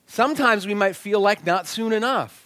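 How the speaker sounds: normal talking voice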